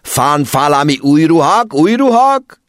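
put-on exasperated accented yelling; foreign-accented shout. A narrator's reading. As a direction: yell the question in exasperation. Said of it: "A vámos dühösen meredt rá".

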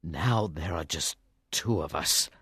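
very low, hushed, sorrowful king; weary sadness of a wounded king; sad. Acted. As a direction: say it softly and deep, grieving. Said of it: "King Arthur said sadly".

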